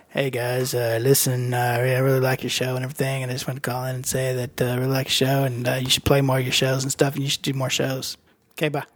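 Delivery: lightly voiced Greg from Tacoma, low and rambling; low dopey caller. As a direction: play a shy fan calling in, low and sheepish. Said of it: "Calling in is Greg from Tacoma".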